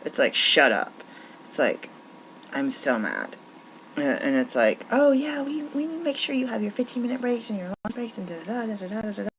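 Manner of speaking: high, sarcastic mimicry of the boss; mock the boss's fake concern. Mocking bosses who demand overtime.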